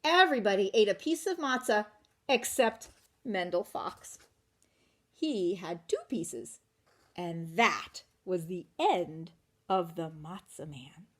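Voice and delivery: ordinary delivery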